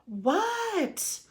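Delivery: high, drawn-out holler; calling back. A narrator's reading. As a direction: holler back to a yelling dad. Froggy answers dad's yell.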